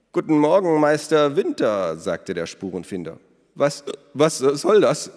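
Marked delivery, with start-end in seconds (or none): 0.1-2.0 cheery greeting. A narrator's reading. Elos greets startled baker. 3.6-5.1 high, loud, slow flustered stammer. A narrator's reading. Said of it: "stammelte der Bäcker".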